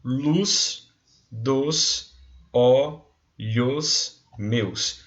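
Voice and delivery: slow, syllable by syllable scansion; syllables spoken apart. Shown as a demonstration. Teacher to class, scanning the verse slowly. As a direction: count each poetic syllable slowly. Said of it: "Então, ó".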